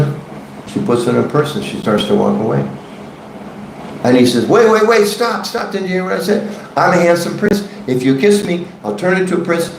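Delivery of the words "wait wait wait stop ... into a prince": high, pleading frog cry, much higher; desperate frog. Acted. Frog begging the woman to come back, high and frantic. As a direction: call her back in panic as the frog.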